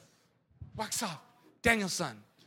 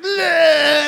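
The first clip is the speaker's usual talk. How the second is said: loud high pained yelp; pained grunt; 6 semitones higher, 12 dB louder than usual. Retold or imitated. Thug hurting his fist on the car, loud yelp. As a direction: cry out as fist smashes glass. Thug's fist hits window.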